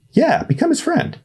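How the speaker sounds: put-on high, fast, hopeful kid voice; a sweet naive boy pushing his idea; eager boy Ernest. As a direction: Ernest urging Ryan to help.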